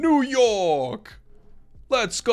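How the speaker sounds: loud, high, slow Brooklyn tough guy; Brooklyn accent. Retold or imitated. Showing co-caster a Brooklyn accent, loud Brooklyn shout. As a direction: belt it out like a New York cabbie. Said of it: "what a Brooklyn accent is".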